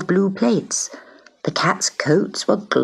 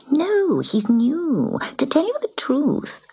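gossipy cat; 9 semitones higher, slower than usual. Acted.

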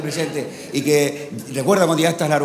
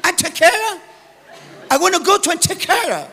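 high, loud, ever more frantic English tourist; exasperated tourist; 13 semitones higher, 10 dB louder, slower than usual. Retold or imitated. Comedian voices a frustrated English tourist shouting at the Andalusian farmer. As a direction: shout the town name louder each time. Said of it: "turista al andaluz".

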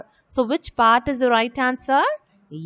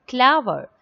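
ordinary talk, nothing marked

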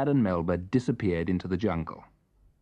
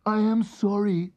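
little elephant; 10 semitones higher than usual. Acted. Melba apologises.

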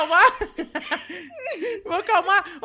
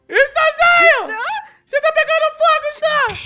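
shouting in alarm; 8 semitones higher, 7 dB louder than usual. Real, not acted. Friend's character catches fire.

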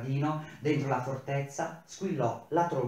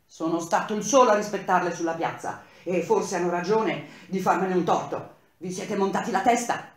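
raised, louder indignant protest; bitter, louder complaint to the workers; angry reproach; 5 semitones higher, 6 dB louder than usual. Acted. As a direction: keep hammering the grievance. Engineer's angry retort continues.

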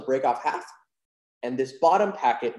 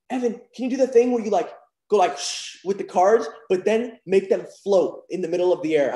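lightly voiced eager six-year-old, higher; a hint of an excited little kid asking; little cousin; 6 semitones higher, 4 dB louder than usual. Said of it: "He's like".

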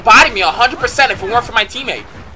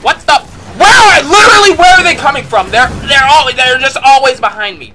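exasperated shout; 6 semitones higher, 8 dB louder than usual. Real, not acted. Enemies keep flanking him.

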